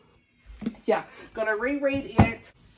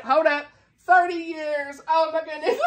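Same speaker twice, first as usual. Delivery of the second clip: higher, laughing realisation; amused disbelief; 6 semitones higher than usual. Real, not acted. Realising to viewers the book is thirty years old, laughing in disbelief. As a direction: catch yourself mid-count, laughing.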